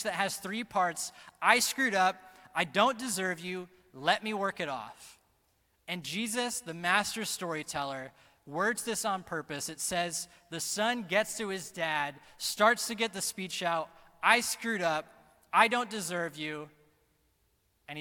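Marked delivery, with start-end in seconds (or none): 1.4-5.2 emphatic confession. Acted. Son owning up to his father, loud emphatic confession. Son's speech summed up emphatically. 14.2-16.7 loud admission, hard attack on each phrase; emphatic confession. Acted. Son starting his speech to dad, loud emphatic confession.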